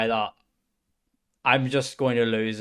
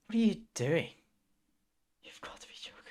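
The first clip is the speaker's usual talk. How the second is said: stunned whisper; 6 semitones higher, 7 dB quieter, faster than usual. Real, not acted. Disbelief again.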